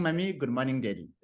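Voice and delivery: usual speaking voice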